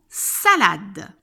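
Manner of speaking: "salade" slow and loud, every sound clear; careful dictation. Shown as a demonstration. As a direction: dictate "salade" with a long initial S. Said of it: "en disant bien tous les sons".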